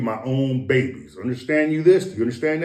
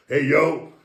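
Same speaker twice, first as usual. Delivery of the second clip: normal talking voice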